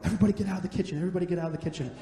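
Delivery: imitating his flustered mom clearing the kitchen; mom shooing everyone. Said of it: "she's like".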